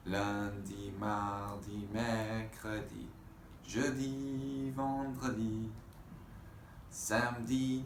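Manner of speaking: slow chanted list of French days; recitation. Acted. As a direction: recite each day like a chant.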